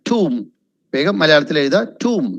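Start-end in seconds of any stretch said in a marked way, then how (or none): 0.1-0.3 Tomb as "toom"; correct pronunciation. Shown as a demonstration. Teacher spelling it t-o-o-m, correct pronunciation. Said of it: "ടി ഒ ഒ എം പോലെ". 2.0-2.3 Tomb as "toom"; long oo in Tomb, silent B; correct pronunciation. Shown as a demonstration. Teacher dictating Tomb to write down, correct pronunciation. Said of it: "ടി ഒ ഒ എം പോലെ".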